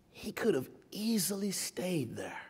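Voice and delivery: soft whispered aside; hushed whisper. Acted. Preacher describing Jesus's choice, hushed whisper. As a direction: drop it to a whisper.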